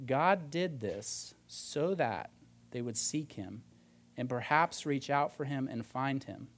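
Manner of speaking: slow, measured Bible reading, falling low at phrase ends; scripture reading. Acted. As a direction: read the verse out slowly and deliberately. Reads a Bible verse aloud.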